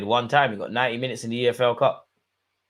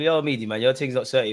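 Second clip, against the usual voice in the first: ordinary talk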